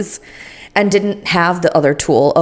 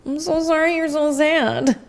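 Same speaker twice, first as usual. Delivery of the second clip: sympathetic coo; 10 semitones higher, 4 dB quieter, slower than usual. Retold or imitated. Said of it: "you're just like".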